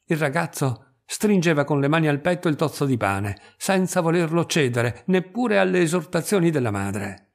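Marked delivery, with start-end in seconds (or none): none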